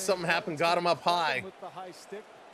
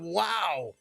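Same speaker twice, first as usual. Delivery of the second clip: normal talking voice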